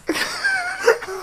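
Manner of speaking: very high-pitched helpless laugh; real high giggling fit. Real, not acted. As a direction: crack up at your own story. Cracks up at own story.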